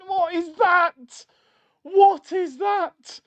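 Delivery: high, drawn-out cry of disbelief; appalled outburst. Real, not acted. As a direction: wail it slowly and high in exasperation at the team. Appalled at play during live watchalong.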